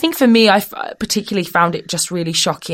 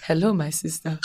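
put-on soft greeting in an African accent; 6 dB quieter than usual. Two strangers lock eyes on a train, put-on African accent. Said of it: "I don't even talk like this".

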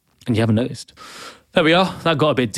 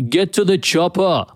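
slow Austrian Arnie impression, a bit higher; Arnie line; 6 semitones higher than usual. Podcaster quoting the iconic movie line, slow mock Austrian accent. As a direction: drawl it like Arnold, slow and heavy. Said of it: "the iconic line".

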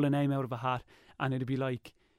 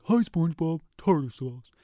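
Patrick voice; 2 semitones higher, 3 dB louder than usual. Retold or imitated. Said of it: "Patrick then, he's like".

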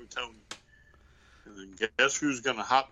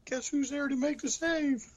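mock cheer; 14 semitones higher than usual. Mock cheer for the surprise save.

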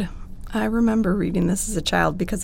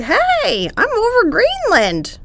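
put-on excited kid voice; 5 dB louder, slower than usual. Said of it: "pointing out the window like".

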